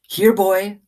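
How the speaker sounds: high call to the dog. Acted. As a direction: coax him over. Girl calls the dog again.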